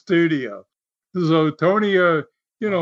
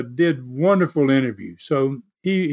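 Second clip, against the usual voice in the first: ordinary delivery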